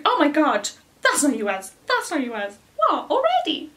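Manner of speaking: high, loud gushing mum; mock squeal of a delighted mother; excited mum. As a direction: gush like a thrilled mum. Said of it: "she was like".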